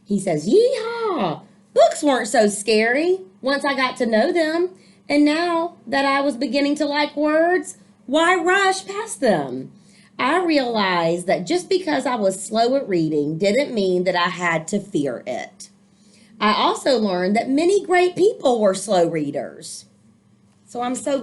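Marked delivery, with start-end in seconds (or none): 0.5-1.4 high whooping yeehaw; excited cheer. Acted. Boy celebrating he's no longer scared, high excited whoop. 1.7-19.9 put-on lively read-aloud for children; storybook reading. A narrator's reading. Teacher reading a picture book to young listeners, lively storytelling.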